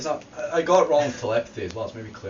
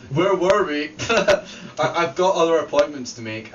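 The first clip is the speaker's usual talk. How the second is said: louder, higher, slow pompous Buffy; mock haughty Buffy; 5 semitones higher, 6 dB louder, slower than usual. Reading fan fiction aloud, Buffy gloats, hammed-up and loud. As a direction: ham up a smug slayer dismissing them.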